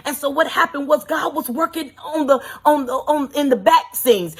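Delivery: usual speaking voice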